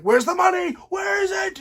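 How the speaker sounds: high, loud retold yell for the money; demanding shout. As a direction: bark it like a frantic husband. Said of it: "Walter's like".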